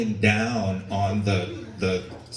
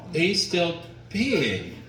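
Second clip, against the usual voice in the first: drawn-out disbelief; 7 semitones higher, much slower than usual. Retold or imitated. Disbelief at dad's endless pee.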